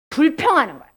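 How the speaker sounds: usual speaking voice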